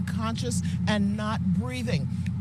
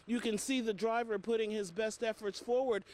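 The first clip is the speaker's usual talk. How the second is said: ordinary talk, nothing marked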